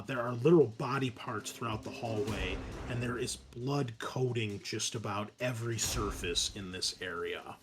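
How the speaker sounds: ordinary talk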